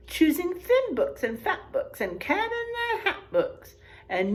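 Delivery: lively read-aloud, big swings on the rhymes; storybook reading. Acted. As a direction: read it to a class of kids with energy. Reading picture book aloud.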